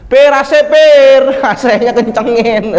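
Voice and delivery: high, loud, laughing demand; demanding shout. Retold or imitated. Complaining pilgrim shouting at the driver, loud and high. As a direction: yell for the AC to be turned up.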